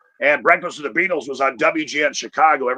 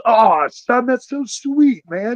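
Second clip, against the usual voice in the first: higher, slow, mellow mom voice; touched stoned mom; 7 semitones higher, slower than usual. Retold or imitated. Hippie mom answers her son, lightly voiced mellow delight. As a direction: play mom as blissed-out hippie. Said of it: "She goes".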